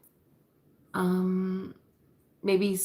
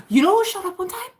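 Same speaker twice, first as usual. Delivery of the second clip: high excited gasping question; 13 semitones higher, 4 dB louder, faster than usual. Real, not acted. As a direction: gasp with excitement. Excited gasp before news.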